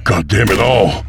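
wounded Sutter curses after being shot; pained curse. Acted.

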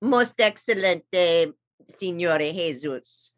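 a hint of a put-on Italian accent. Squirrel Coney greets host Jesus, light Italian accent. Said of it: "And in that accent".

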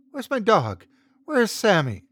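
high, loud frantic questions; panicked. Acted. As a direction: call out desperately for the lost dog. Frightened girl asking for dog.

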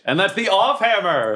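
high, drawn-out gloating cheer; thrilled, stretching the words; real excitement. Real, not acted. Celebrates max damage roll.

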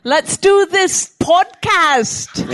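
loud, drawn-out fake Jamaican accent; high, stretched, hammed-up Jamaican accent. Shown as a demonstration. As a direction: belt out a bad Jamaican accent with an Irish tinge. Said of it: "You do Jamaican accents".

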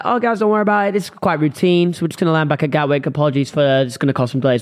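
ordinary talk, nothing marked

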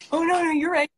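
high-pitched, placating retort; mock hasty agreement. Giving in to dismissive people.

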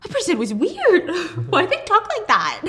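spooked and amused at the weirdo; laughing mock disbelief. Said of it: "I'd be like".

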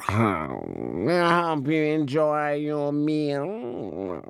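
slow, purring cat voice; a smug cat serving dinner, slow and drawn out. Acted. Cat serves parrot dinner.

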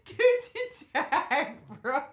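slow laughing tourist greeting; imitating tourist German. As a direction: mimic the silly bro greeting. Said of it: "You said".

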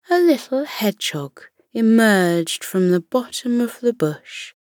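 plain reading voice

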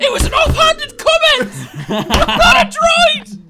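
high, full-volume shouted protest; exasperated high-pitched yelling over laughter; outraged shout. Real, not acted. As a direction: yell the denial at the top of your voice. Teased, yelling denial.

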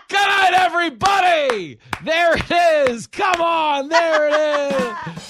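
high, loud, slow laughing shout. Real, not acted. Host cracking up at his own cannibal joke, laughing and shouting.

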